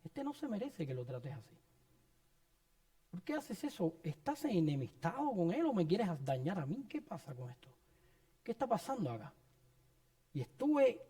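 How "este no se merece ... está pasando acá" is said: quiet, resentful prayer; hushed complaint to God. Retold or imitated. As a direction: murmur the grievance under your breath. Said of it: "yo estaba diciéndole a Dios".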